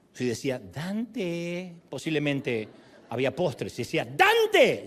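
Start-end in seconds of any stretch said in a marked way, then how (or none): 0.6-1.7 soft, lingering imitated call of a name; mother's sweet call. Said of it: "me llamaba por encima de las otras voces por su tono". 4.0-4.8 high, loud imitated shout of a name; sharp mock-mother holler; mother's urgent call. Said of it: "ya llevaba una hora de atraso para la comida".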